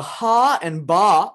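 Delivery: ha and ba held long and slow; long syllables. Shown as a demonstration. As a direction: draw out the vowels of ha and ba. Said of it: "are long syllables".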